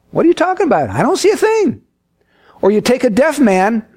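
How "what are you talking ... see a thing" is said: high-pitched, bewildered retort; baffled protest. Acted. Blind man answering his excited guide, baffled. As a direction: protest that you are missing it entirely.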